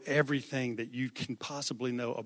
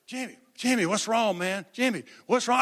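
higher, louder worried call; urgent calling; 7 semitones higher, 6 dB louder than usual. Retold or imitated. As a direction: call out to the boy in alarm. Friends calling to crying boy.

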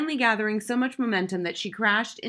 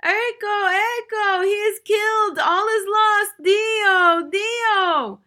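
high, loud wailing shriek; operatic scream; 13 semitones higher, 9 dB louder, much slower than usual. Acted. Fainting mother screams that her son is killed, operatic wail. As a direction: wail like a dying opera singer. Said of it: "managed to scream as loud as a dying opera singer".

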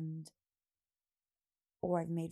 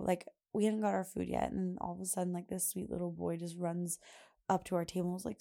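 ordinary delivery

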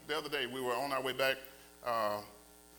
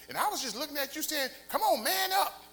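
wife teasing; 9 semitones higher, 4 dB louder than usual. Retold or imitated. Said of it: "listen to what she said".

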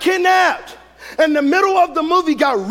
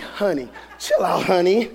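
low, soft hushing of a panicking wife; mock-patient, quiet reassurance; calm husband; 8 semitones lower, 4 dB quieter than usual. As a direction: coolly settle someone down, much lower and softer. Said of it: "I'm sitting there calm".

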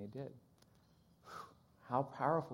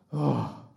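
big intake, then loud "oh" of relief; relieved gasp and sigh; 9 dB louder than usual. Retold or imitated. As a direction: let the relief out in one heavy breath. Relieved translator reacts to praise.

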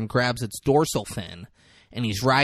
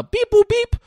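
high, loud imitated electronic beeps; gate beeps; 9 dB louder than usual. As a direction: chirp the gate sound. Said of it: "noise when it closes".

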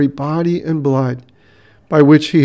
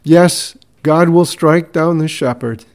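usual speaking voice

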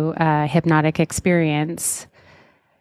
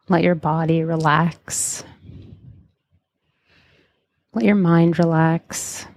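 guided meditation. Acted. Leads a hypnotic guided meditation.

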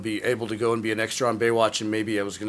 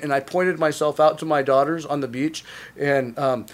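normal talking voice

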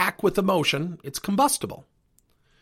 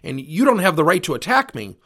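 mock defensive retort, a bit louder; 3 semitones higher, 7 dB louder than usual. Coach hits back at a complaining parent, mildly defensive. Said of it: "instead of saying defensively".

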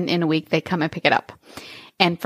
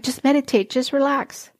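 mocking advice; 6 semitones higher than usual. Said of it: "when I would hear people say".

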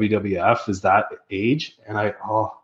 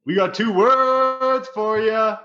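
Road Dogg intro; 5 semitones higher than usual. Pretend. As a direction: stretch each name like a wrestling announcer. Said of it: "Can I do the whole Road Dogg Jesse James intro".

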